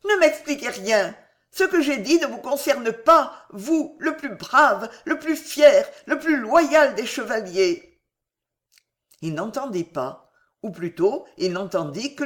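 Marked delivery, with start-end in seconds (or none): 0.1-8.0 impassioned cry. A narrator's reading. Woman absolving the despairing man, crying out fervently. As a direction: cry it out with your whole being, pitched up. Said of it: "elle cria".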